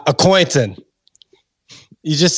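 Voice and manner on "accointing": Boston accent. Shown as a demonstration. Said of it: "How would you say that with a Boston accent".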